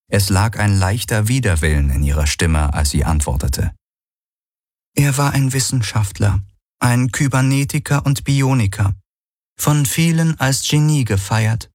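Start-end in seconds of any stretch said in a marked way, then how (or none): 5.0-11.7 higher, cool and reserved; reluctant AI voice. A narrator's reading. Helix describes Valyr to Galen, higher and reluctant. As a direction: speak with slight reluctance, voice raised.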